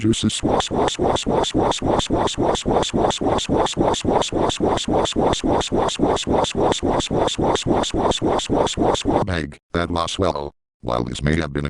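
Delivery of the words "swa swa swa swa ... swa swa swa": breathy repeated swa swa; voiceless rhythmic swooshing; whispered swish noises. Acted. Rhythmic thrust noise effect.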